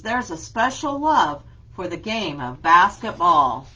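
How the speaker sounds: lively read-aloud with a steady rhyme beat; reading to children. Acted. Teacher reads an alphabet book to her class, lively and rhythmic.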